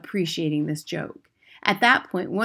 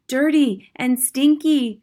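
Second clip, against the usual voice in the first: higher, louder, slow excited cry; worked-up cry; 8 semitones higher, 7 dB louder, slower than usual. Acted. Witch audience crying out, worked up by their leader. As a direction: echo her, getting worked up. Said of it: "cried the audience, getting more and more worked up".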